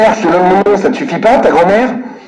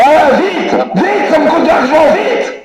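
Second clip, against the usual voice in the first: laughing hurry; 7 semitones higher, slower than usual. Real, not acted. Caller hurrying the phone agent.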